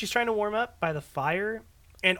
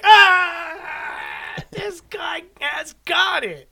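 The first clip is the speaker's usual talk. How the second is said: high, drawn-out mock whoop; exaggerated, high-pitched imitation of being thrilled; hammed-up delight; 9 semitones higher, 5 dB louder, much slower than usual. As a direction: shout it slowly like an over-amused fan. Imitates characters delighted by dancing toy.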